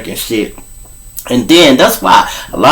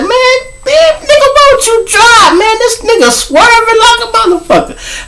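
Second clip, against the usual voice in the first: shrill-high woman complaining, much higher; high mock woman. Imitating his friend complaining about his driving, high woman's voice. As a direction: play her nagging from the passenger seat.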